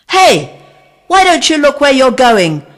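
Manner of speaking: high, loud shout from up a tree. A narrator's reading. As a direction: yell down at her, annoyed. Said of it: "shouted a voice from a tree".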